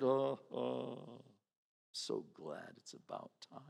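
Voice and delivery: hushed, low gloating murmur. Retold or imitated. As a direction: quietly savour their bad luck. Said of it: "you ever have that".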